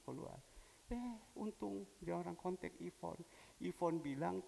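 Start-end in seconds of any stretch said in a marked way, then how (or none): none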